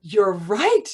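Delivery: high and slow with delight; a sudden gleeful relief, drawn out; joyful realisation. Retold or imitated. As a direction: Zusha thrilled he's serving God. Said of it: "get up with joy and glee".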